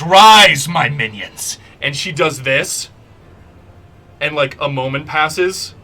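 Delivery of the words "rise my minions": a god's booming command to rise, loud; thunderous summons to minions; commanding cry. Acted. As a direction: summon the dead with a booming shout. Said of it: "And she goes".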